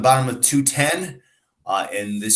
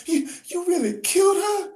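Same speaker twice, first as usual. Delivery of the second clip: high, crying question; tearful disbelief. Acted. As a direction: sob out the question in shock. Bessie learns of the killing.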